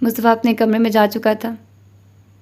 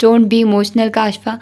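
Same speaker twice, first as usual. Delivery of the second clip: plain reading voice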